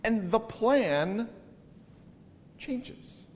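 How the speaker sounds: slow "the plan", then "changes" whispered; long beat, whisper "changes"; dramatic pause. Shown as a demonstration. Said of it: "Do you see what happened there?".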